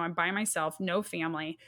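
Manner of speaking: ordinary delivery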